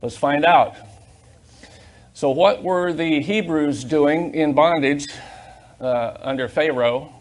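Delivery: loud, driving preacher's cadence, words hammered out; preaching at full force. Acted. Pastor rousing his church, preaching loud and hard. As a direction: preach it to the congregation, building each phrase.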